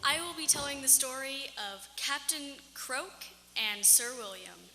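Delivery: ordinary delivery, nothing marked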